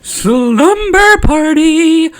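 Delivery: very high, loud, drawn-out cheer; excited squeal. Acted. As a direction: stretch it out in a gleeful high shout. Child excitedly proposing a sleepover.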